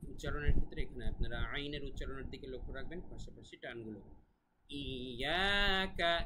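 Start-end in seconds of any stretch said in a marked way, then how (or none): 4.7-6.1 "ইয়াকা" high, with the middle vowel drawn out; elongated vowel. Shown as a demonstration. Teacher showing one alif of stretch, elongated "ইয়াকা". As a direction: hold the "য়া" of "ইয়াকা" long. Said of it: "এক আলিফ টান এখানে".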